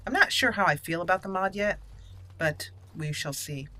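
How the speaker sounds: ordinary delivery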